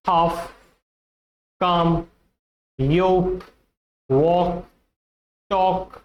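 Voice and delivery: "half", "काम", "yoke", "walk", "talk" with no L; silent L. Shown as a demonstration. Teacher lists examples for students, L silent. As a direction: say each word apart, "walk" as wawk, "talk" as tawk. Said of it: "the L is silent".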